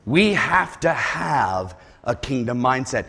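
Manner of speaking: ordinary talk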